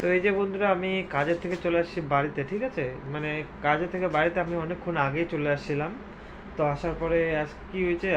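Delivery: low, soft voice while others sleep; quiet late-night murmur to the camera; hushed night talk. Real, not acted. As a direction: keep it down, everyone's asleep. Late night, household asleep.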